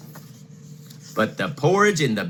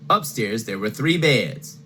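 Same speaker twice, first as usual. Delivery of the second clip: usual speaking voice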